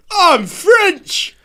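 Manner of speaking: high, loud hammed-up French accent; mock French accent. Friend mocking lazy accents, hammed-up French accent. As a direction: ham up a bad French accent. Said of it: "but it shit if I'm like".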